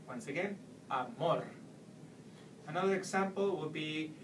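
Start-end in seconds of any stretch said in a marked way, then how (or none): 0.9-1.4 "amor" with a punched final MOR; stress on last syllable. Shown as a demonstration. Teacher repeating the example once again, final-syllable stress. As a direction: lean on the end of "amor". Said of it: "stress the ending of the word".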